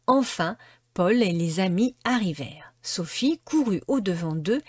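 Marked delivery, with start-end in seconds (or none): none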